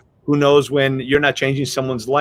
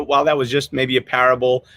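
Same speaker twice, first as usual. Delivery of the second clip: normal talking voice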